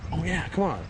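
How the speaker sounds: dog's voice. Retold or imitated. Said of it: "And he goes".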